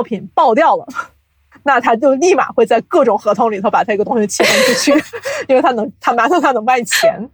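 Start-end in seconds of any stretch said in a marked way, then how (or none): 5.0-5.4 a quick high rising giggle. Real, not acted. Host giggles about studios carving up rights, high giggle. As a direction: giggle at how fast they cash in.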